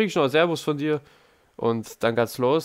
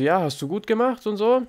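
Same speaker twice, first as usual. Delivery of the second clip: high, louder mock praise from the instructor; praising instructor; 10 semitones higher, 4 dB louder than usual. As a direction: ham up the teacher's pat on the back. Fahrlehrer lobt nachgemacht. (hoch nachgeäffter Fahrlehrer, übertrieben lobend)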